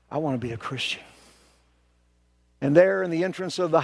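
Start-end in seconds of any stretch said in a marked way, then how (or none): 0.1-1.0 softer, lower, quiet plea; humble confession. Acted.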